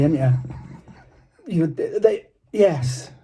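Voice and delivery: usual speaking voice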